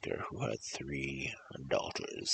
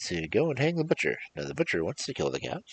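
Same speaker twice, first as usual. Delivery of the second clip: lively storybook read-aloud, louder on each new character; story narration; 5 semitones higher, 5 dB louder, faster than usual. Acted. Reading a folk tale to viewers, lively storybook narration. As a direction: tell the cumulative tale to listeners, building each line. Retakes the tale's ending.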